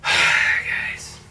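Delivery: low, voiceless sighed word; weary sigh. Real, not acted. Reviewer to viewers after the rant, weary sigh. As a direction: let it out on a tired exhale.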